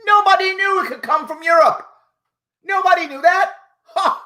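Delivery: high-pitched incredulous echo; mock disbelief. Commentator echoing the governor's claim about Europe, mock incredulous. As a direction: throw the claim back in mock astonishment.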